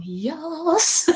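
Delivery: high, drawn-out rising yes; gleeful acceptance. Retold or imitated. Composer accepting a really weird brief, imitated gleeful yes. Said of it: "given a really weird brief and gone".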